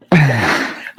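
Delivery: embarrassed laugh at own slip; helpless laugh after a fumbled line; real laugh. Real, not acted. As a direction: crack up over your own mix-up. Laughing at his own name mix-up.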